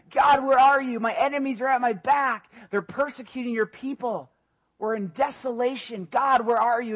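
high, loud pleading cry to God. Acted. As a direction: cry out like a desperate psalmist. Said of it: "the psalmist is crying out".

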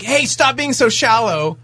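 ordinary delivery

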